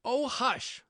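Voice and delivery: high, alarmed, not whispered; frightened hushing. A narrator's reading. Rabbit silencing laughing Alice, frightened. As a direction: shush anxiously in a high voice. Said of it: "whispered in a frightened tone".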